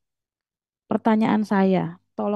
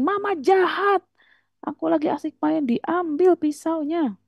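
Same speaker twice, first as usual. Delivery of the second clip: high-pitched, whining accusation; hammed-up child's wail at mama; mock yelling child; 8 semitones higher than usual. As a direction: imitate a tantruming kid, dragging out the end. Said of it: "makin teriak".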